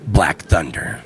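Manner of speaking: deep, slow trailer announcer voice; growly movie narrator voice. Pretend. Fake movie trailer voice-over.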